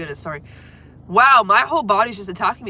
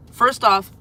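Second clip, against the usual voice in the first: usual speaking voice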